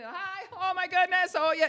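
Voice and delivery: very high, gushing impression of a loud woman; loud, over-excited lady. Imitating a lady when she comes in, loud and gushing. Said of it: "she talks like this".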